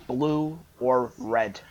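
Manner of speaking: each word drawn out slowly, Blue or red spaced apart; slow, pointed repeat. Retold or imitated. Girl repeating her question to the boy slowly, pointedly. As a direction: ask it again slowly, leaning on every word. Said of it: "tilts her head down".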